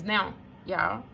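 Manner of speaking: ordinary talk, nothing marked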